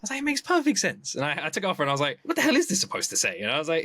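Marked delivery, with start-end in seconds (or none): 0.2-1.1 high, indignant mock protest; defensive insistence. Defending his backwards bracelet to his wife, high and indignant. 2.2-3.4 high, fast incredulous question; suddenly baffled by your own work. Retold or imitated. Reading his own bracelet and realizing it's nonsense, fast and high.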